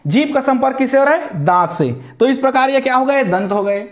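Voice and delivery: ordinary talk, nothing marked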